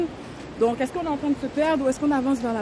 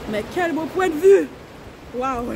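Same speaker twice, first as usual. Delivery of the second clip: excited; 3 semitones higher, 4 dB louder than usual. Real, not acted. Sees the view.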